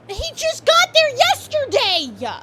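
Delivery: very high, drawn-out yell; hammed-up squealing protest at full volume; outraged shout. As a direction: shriek the objection at the court. Acts protesting game character.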